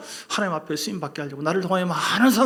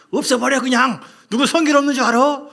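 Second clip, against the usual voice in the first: loud, much higher commanding yell; angry shout; 10 semitones higher, 10 dB louder than usual. Pretend. Preacher voicing a vengeful Joseph, loud angry shout. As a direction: bark the order to destroy them. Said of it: "지금 왼수를 만났는데 당장 그 권세로".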